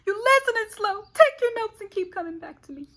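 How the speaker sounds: high, loud, teasing; high-pitched playful tease. Real, not acted. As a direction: sing it up high to the viewers. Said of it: "I know I'm talking fast".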